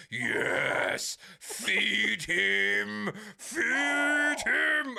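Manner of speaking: gleefully urging his creation on; mad doctor voice. Retold or imitated. Doctor in the film cries out, mock mad scientist. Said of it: "he turns around and goes".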